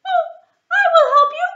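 very high, loud eager squeak; helpful mouse. Acted. Mouse offering to free the lion, very high. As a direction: rush in to rescue.

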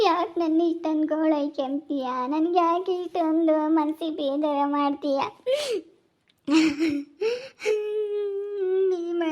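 soft, slow, tearful pleading; pleading through tears, almost fading away; tearful plea. Acted. Wife pleading with her husband, softly through tears. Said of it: "ಅಳೋದೊಂದು ಕಲ್ತವಳೆ".

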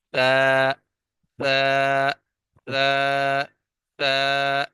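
تا with the tongue tip lightly between the teeth; tongue-tip letter drill. Shown as a demonstration. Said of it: "زبان کی نوک ہلکی سی باہر نکالیں گے".

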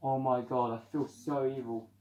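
ordinary talk, nothing marked

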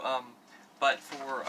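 ordinary talk

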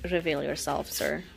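ordinary talk

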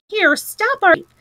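high, loud, exasperated cry. A narrator's reading. Rat despairs of mole's obtuseness, exasperated. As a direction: cut him off in despair. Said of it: "cried the rat in despair".

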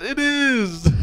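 high, drawn-out insistence; exaggerated squeal of disagreement; mock protest. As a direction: imitate an indignant player. Acting out player's insistence.

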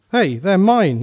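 loud, high cry of recognition; shout. A narrator's reading. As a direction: yell out as you spot your own limbs. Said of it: "he shouted".

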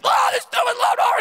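high, loud shout at the lawnmower; dad finally losing it at the mower; mock angry grumble. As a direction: blow up at a stupid machine.

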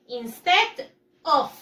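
Instead of slow and firm, the of kept; slow it down and keep the of; stress on Instead of. Shown as a demonstration. Teacher reminding pupils of the phrase, slow and firm. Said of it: "Recuerden lo que les dije".

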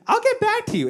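very high squeaked excuse; flustered brush-off. Retold or imitated. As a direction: imitate a panicked, polite exit. Flustered HR escaping in retelling.

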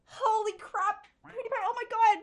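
real scare, voice jumping high and loud; startled. Real, not acted. Streamer jolted by a sudden loud stream alert, startled. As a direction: blurt it out, jolted by a fright. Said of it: "that scared the crap out of me".

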